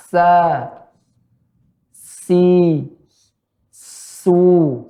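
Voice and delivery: SA, SI, SU with sound streaming through the S; long light hiss into each of "Sa, si, su"; flowing S. Shown as a demonstration. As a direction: stretch "Sa, si, su". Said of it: "ada aliran suara".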